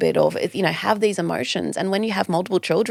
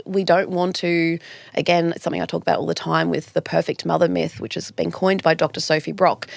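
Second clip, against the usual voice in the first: usual speaking voice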